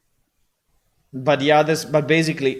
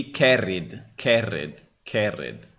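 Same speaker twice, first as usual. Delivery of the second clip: carried with a rolled Italian R, dropping lower; Italian accent; 5 semitones lower, slower than usual. Shown as a demonstration. Showing the AI voice how carried should sound in Italian. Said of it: "is not Italian enough".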